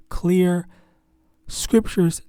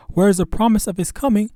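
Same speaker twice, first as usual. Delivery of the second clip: higher, louder scoffing quote; scoffing question; 5 semitones higher, 5 dB louder than usual. Acted. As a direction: read it as a scoffer's taunt. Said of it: "this is a scoffing".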